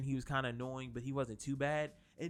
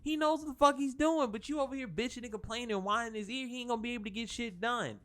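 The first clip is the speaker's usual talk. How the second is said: exasperated rant; 6 semitones higher than usual. Retold or imitated. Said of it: "and I was like".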